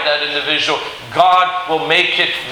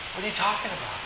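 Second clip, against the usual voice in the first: very soft, taken aback; aghast, hushed; 3 semitones higher, 13 dB quieter, faster than usual. Acted. Joseph answering his scared brothers, aghast and hushed. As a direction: say it aghast, almost under your breath. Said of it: "Joseph is aghast".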